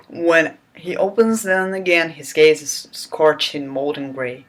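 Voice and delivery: ordinary delivery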